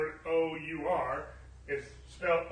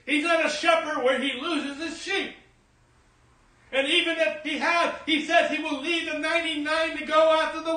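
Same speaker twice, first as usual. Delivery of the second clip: rhythmic litany rising into a loud cry; preaching, building to full force; 6 semitones higher, 8 dB louder than usual. Real, not acted. Preacher declaring who the Lord is, building fervent preaching. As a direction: build each declaration higher and louder.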